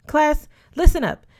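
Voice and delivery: loud, high call to order; commanding call. A narrator's reading. Teacher calling his class to attention, loud and high.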